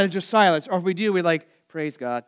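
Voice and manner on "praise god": mock subdued Lutheran "Praise God," said low and quiet; flat low-energy praise. Pastor mocking a quiet congregation, flat and quiet. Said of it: "There's not a lot of energy".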